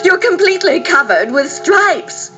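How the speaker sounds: high-pitched story-time dismay; alarmed cry. A narrator's reading. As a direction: exclaim as a horrified mother. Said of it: "she cried".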